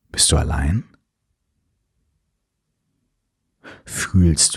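calm, unhurried breathing cues; guided meditation. Acted.

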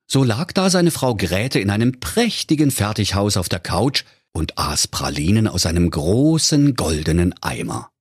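usual speaking voice

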